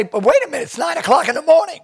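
high, disbelieving objection; incredulous protest. Retold or imitated. His own retold protest.

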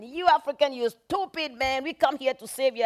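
hammed-up bigot; mocking a bigot. Racist soldier voiced in story.

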